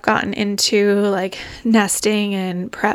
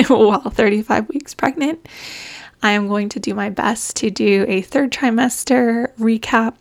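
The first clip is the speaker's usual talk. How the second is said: ordinary delivery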